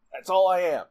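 ordinary talk, nothing marked

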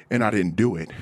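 usual speaking voice

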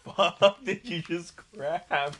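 slow, laughing disbelief. Real, not acted. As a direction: laugh through the question. Laughing at friend's snack.

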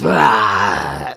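loud, low mock roar; Mama Bear growl. Mama Bear sniffs the porridge, loud growl. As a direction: let out a bear's roar. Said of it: "and she goes".

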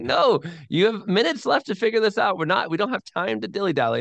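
imitating an urgent old preacher; urgent blunt retort. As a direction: cut them off, time is running out. Mather's reply in the retelling.

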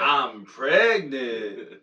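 very slow stunned disbelief; mock shock. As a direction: say it slowly in disbelief. Acts shocked player.

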